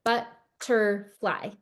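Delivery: "butterfly" said very slowly as but-ter-fly; chopped into syllables. Shown as a demonstration. Teacher showing students syllable beats, slow chopped "butterfly". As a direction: tap out each beat of "butterfly". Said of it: "that has three".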